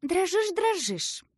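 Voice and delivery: high, dismissive double reply; brisk contradiction. A narrator's reading. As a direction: brush the denial aside. Bun contradicts Asya dismissively.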